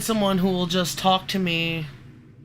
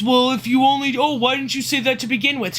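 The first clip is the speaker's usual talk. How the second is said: mocking whiny retort; 6 semitones higher, 3 dB louder, faster than usual. Mocking the other person's retort.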